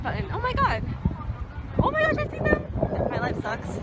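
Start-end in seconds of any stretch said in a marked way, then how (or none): 0.3-2.7 excited spotting. Real, not acted. Spotting friends playing hide and seek.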